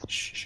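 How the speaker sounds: soft, voiceless shushing; a quiet "shh, shh" to gather people; hushing. Acted. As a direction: hush the group softly.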